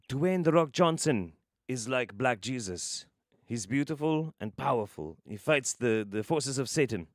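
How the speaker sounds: lightly put-on African accent; measured African-accented impression; slightly African accent. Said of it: "I can actually do a slightly African accent".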